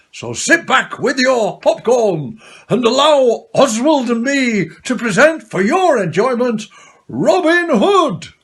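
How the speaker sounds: ordinary delivery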